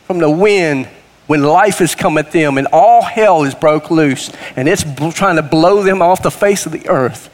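usual speaking voice

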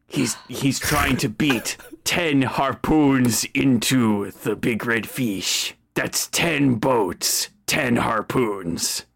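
low, slow explaining voice; drawn-out matter-of-fact sidekick; slow low henchman. Acted.

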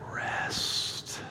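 long breathy exhaled whisper of Rest; whispered, drawn out. Shown as a demonstration. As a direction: let Rest linger on the outbreath, whispered. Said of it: "say it slowly and quietly".

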